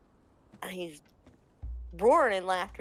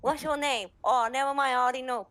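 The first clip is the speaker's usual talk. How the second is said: higher, louder playful broken-English jab; Ping Ting teasing; 6 semitones higher, 6 dB louder, faster than usual. Retold or imitated. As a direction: tease him like you already know everything. Ping Ting teasing Chao Jin.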